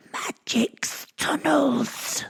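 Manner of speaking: very high, very slow gremlin elf voice; squeaky elf. Acted. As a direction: stretch it out in a tiny goblin squeak. Elf answers after slapping him.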